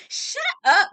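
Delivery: high, loud "Shut up," at Dorit; hammed-up exasperated yell; mock shout. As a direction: bark it at her in exasperation.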